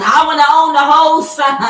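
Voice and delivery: loud, high mimicry of a greedy whiner; mock tantrum. Reader mocks the man controlling the sun, mock tantrum. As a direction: belt it out like a spoiled brat.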